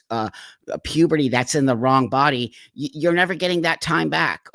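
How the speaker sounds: ordinary talk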